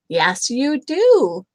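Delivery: loud, slow insisting; Fluffy Bear insisting. A narrator's reading. Bear arguing with her smelly friend, loud and slow. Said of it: "said Big Fluffy Bear".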